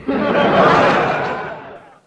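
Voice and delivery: loud high crowd laughter; the house roars with laughter; radio audience bursting out laughing; big audience laugh. Acted.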